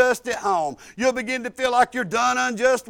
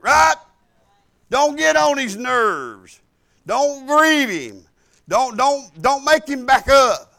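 loud, slow, pounding sermon lines; fiery preaching; 7 dB louder than usual. Acted. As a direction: preach it hard to the congregation. Preaching at full force.

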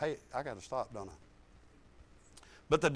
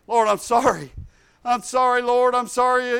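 loud repentant prayer; 4 semitones higher, 8 dB louder than usual. Retold or imitated.

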